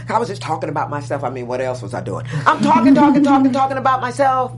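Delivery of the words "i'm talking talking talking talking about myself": loud, higher, repetitive babble; self-mocking chatter. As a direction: rattle on loudly, sending up your own chatter. Mocks own endless self-centred chatter.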